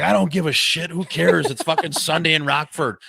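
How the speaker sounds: mock loud shrugging referee; careless ref. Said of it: "He's like".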